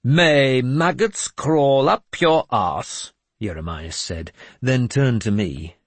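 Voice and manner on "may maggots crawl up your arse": high, loud, very slow mock blessing curse; lofty mad bishop curse; slow grand curse. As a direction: curse him like you bless him. Jeremias curses priest.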